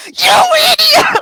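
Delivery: high, loud, drawn-out shout; acted-out furious yell; furious scream. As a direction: scream it at your brother, stretched out. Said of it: "I was so mad".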